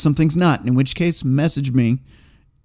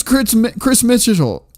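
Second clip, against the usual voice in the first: fumbled name; 8 semitones higher than usual. Real, not acted. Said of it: "I can't say Mitchell".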